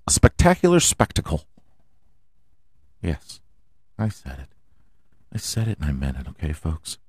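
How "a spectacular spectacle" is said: spectacular hit high and fast; emphatic announcer. Shown as a demonstration. Host hyping the NASCAR opener, high emphatic announcer. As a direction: sell spectacular like a showman. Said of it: "they have a spectacular spectacle".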